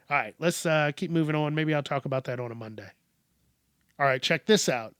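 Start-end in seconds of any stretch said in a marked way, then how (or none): none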